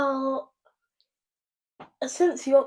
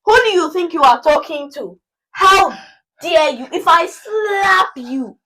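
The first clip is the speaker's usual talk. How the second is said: loud, high, indignant Nigerian auntie; outraged auntie telling off cheeky youngster; offended Nigerian elder; 5 semitones higher, 14 dB louder, slower than usual. Acted. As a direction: scold like an outraged older auntie, drawing out the threat. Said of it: "someone older comes around".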